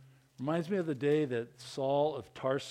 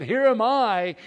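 loud, high answer to a call; eager Isaiah; 8 semitones higher, 11 dB louder than usual. Acted. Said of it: "and Isaiah said".

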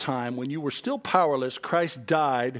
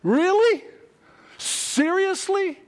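high-pitched, loud disbelief; squeaky, scandalised astonishment; incredulous; 14 semitones higher, 4 dB louder than usual. Acted. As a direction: fire off each question in mock outrage. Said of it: "today we would say".

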